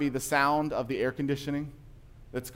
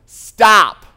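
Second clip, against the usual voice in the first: a sudden, much louder, higher yell of alarm; panicked yell; 7 semitones higher, 19 dB louder than usual. Retold or imitated. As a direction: shout it out as if you're slipping off. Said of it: "and she yells".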